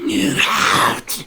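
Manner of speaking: harsh voiceless ghostly shout. Acted. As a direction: hiss it out like a spirit. We hear.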